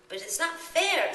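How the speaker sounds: high, loud, slow cry of unfairness; angry protest. A narrator's reading. Coraline protests to the ghost children, angry and upset. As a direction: protest hotly. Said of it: "said Coraline in her dream angrily".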